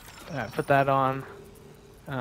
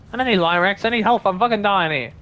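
frustrated; 5 semitones higher, 5 dB louder than usual. Real, not acted. Low health, frustrated looting.

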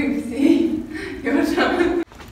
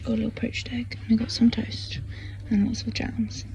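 whispering; 5 dB quieter than usual. Real, not acted. Quiet breakfast, whispering.

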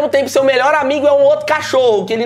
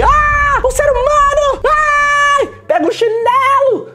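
mock panicked shriek; much slower than usual. Vlogger mocking himself panicking at a human, shrieking high. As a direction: shriek like you spotted a cockroach. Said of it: "Eu nunca vi um ser humano e falei".

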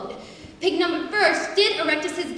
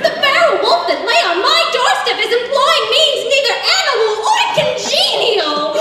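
high, loud hammed-up alarm; dismayed pig; 8 semitones higher, 7 dB louder than usual. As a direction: plead loudly in mock-heroic panic. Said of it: "cry out in dismay".

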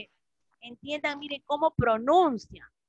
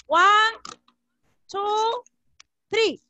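raised, higher count with spaced beats; loud count-in; 7 semitones higher, 6 dB louder, much slower than usual. Real, not acted. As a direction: count the class in, high and projected. Teacher counts the class in. (conteo en voz alta y aguda)

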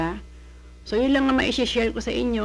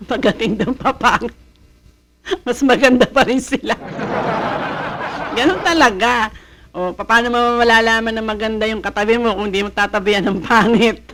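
laughing loudly through a joke about looks; 3 semitones higher, 6 dB louder than usual. Real, not acted. Vlogger laughing at her own looks joke, loud and giggly. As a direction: giggle and push the words out loud.

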